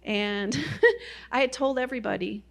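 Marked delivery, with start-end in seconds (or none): 0.6-1.3 short high laugh; burst of laughter. Real, not acted. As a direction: laugh it off, bright and loud. Laughing at her failed hike.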